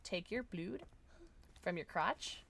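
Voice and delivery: ordinary delivery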